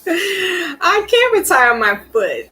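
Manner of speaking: slow, high, laughing half-sob; laugh-cry at your own fate; laughing through it. Real, not acted. Fan laughing about his Steelers foot tattoo, laugh-crying.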